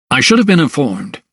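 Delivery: plain reading voice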